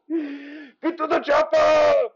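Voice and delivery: high, stretched-out Austrian yell; hammed-up urgent catchphrase; Arnold. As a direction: bellow it like an action hero. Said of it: "here is Arnold".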